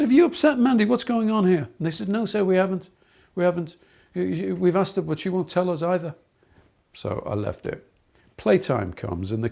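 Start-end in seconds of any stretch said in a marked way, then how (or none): 0.0-1.7 higher and louder, stern teacher questioning; stern demand. Retold or imitated. Teacher challenges other kids. 2.1-6.1 imitated children. Children answer the teacher.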